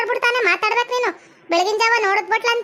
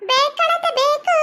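higher-pitched mimicry of a plea; mocking echo; 5 semitones higher, much slower than usual. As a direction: parrot the pleading words back, pitched up. Mocking repeat of the other's plea. (ಅಣಕಿಸುವ ಎತ್ತರದ ದನಿ)

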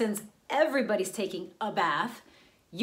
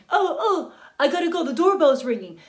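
loud, raised-pitch panicky excuse; boyfriend's loud, flustered brush-off; 6 semitones higher, 9 dB louder than usual. Acted. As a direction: blurt it out to escape the call. Boyfriend makes excuse to hang up.

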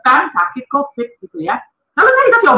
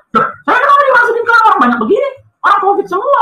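higher, bewildered protest as retold; alarmed protest; 10 semitones higher than usual. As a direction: retell a startled objection, pitched up. Retelling own alarmed protest.

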